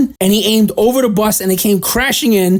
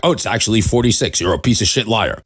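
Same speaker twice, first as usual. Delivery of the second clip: mock fact-checker; 8 semitones lower than usual. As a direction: drop low and sneer like a pedantic know-it-all. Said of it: "Fact check, fact".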